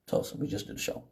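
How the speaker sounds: barely audible whisper; whispered answer. Retold or imitated. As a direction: murmur it like you don't want trouble. Each answering police quietly.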